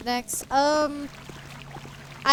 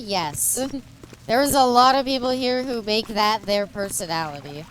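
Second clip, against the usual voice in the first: ordinary delivery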